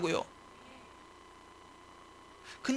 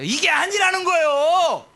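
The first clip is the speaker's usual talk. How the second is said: high, loud, drawn-out cry; slow, forceful shout of objection; emphatic rebuttal; 7 semitones higher, 6 dB louder, slower than usual. Real, not acted. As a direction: thunder it from the pulpit. Preacher rejects the defeatism he acted.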